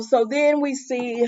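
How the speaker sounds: ordinary talk, nothing marked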